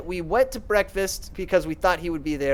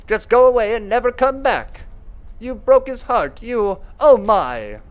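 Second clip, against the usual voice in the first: higher and louder, a distraught woman pushing her away; upset tearful woman; 5 semitones higher, 4 dB louder than usual. Acted. Clarissa sobbing at Veronica, high, loud distraught voice. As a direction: hurl it at her through tears, slowing at the end.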